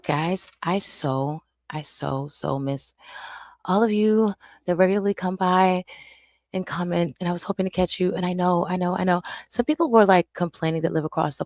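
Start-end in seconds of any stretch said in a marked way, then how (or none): none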